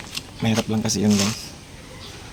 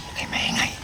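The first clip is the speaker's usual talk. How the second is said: whispering; 13 dB quieter than usual. Real, not acted. Sneaking up, noise remark.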